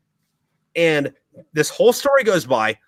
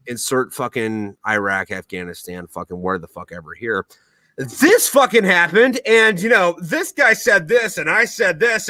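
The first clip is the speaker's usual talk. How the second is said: normal talking voice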